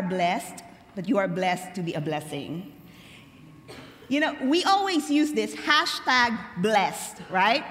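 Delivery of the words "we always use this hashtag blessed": ordinary talk, nothing marked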